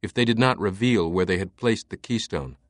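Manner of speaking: usual speaking voice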